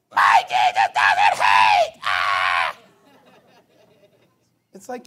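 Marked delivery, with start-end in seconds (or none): 0.1-2.8 low, very loud, drawn-out mock scream; screaming protester impression. Preacher mimics a critic screaming at him, bellowed. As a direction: bellow it slowly like a raging heckler. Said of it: "You're screaming at me".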